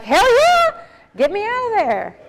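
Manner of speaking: high, loud, thrilled shout of relief; eager yes. Retold or imitated. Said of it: "She'd be like".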